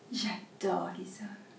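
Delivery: soft whisper. Acted. A young dishwasher secretly confessing she loves men, softly whispered. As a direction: breathe the confession almost to herself.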